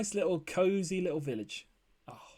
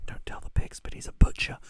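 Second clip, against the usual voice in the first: hushed conspiratorial whisper; 8 dB louder than usual. Pretend. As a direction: whisper it so the pigs can't hear. Secret aside about the pigs.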